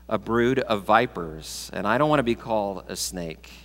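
ordinary delivery

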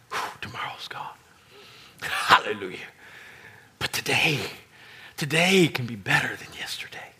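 whispered relief and hope; relieved whisper. Retold or imitated. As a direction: sigh it out, breathy and hopeful. Quoting relieved believer.

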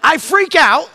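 ordinary delivery

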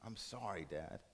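very soft, low acted whisper; barely voiced, falling away; whispered apology. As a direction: drop to a hushed, weak murmur. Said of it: "And he whispers".

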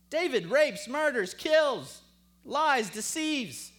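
loud, high and slow; forceful preaching list. Real, not acted. Preacher listing David's sins to the congregation, forceful. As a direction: hammer out each sin separately.

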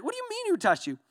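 very high, disbelieving squeal with a laugh; mock the question in a high voice; incredulous disciple. Disciples' incredulous reply again.

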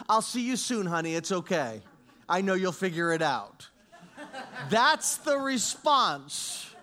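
loud, fervent pulpit delivery; preaching at full force. Acted. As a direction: preach it out to the whole room, full voice. Preaches loudly to congregation.

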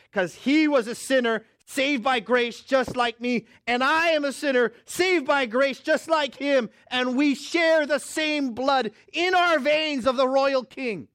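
loud, high, slow pulpit preaching; preaching at full force. Acted. Pastor preaching to his congregation about grace, slow full-force preaching. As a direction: drive each phrase home from the pulpit.

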